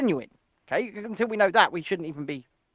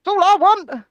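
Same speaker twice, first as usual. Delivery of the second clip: loud, high exasperated outburst; 10 semitones higher, 8 dB louder than usual. Real, not acted. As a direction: vent it loudly in frustration. Venting about dentistry.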